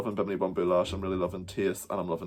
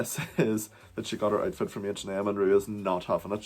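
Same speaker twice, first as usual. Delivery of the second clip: ordinary talk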